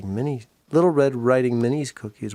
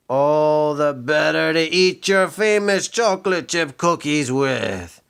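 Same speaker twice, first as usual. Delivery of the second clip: louder, slow triumphant shout; 4 semitones higher, 5 dB louder, slower than usual. Acted. Pete grabbing the cookies, slow loud shout. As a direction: bellow it, springing the trap. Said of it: "he shouted".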